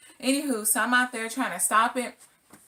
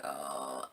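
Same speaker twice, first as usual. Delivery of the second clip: dazed groan; 10 dB quieter than usual. Retold or imitated. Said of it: "I was on the ground like".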